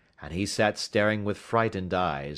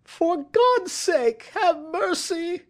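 high, drawn-out desperate wail; panicked begging at the top of the voice; shrieking plea; 6 dB louder, slower than usual. A narrator's reading. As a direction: shriek for mercy, loud and slow. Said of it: "he shrieked".

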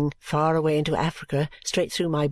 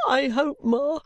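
high, tearful hoping; acted weeping daughter, pitched high; sobbing; 7 semitones higher, slower than usual. A narrator's reading. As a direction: appeal to mother through sobs. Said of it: "sobbed poor Caddy at last".

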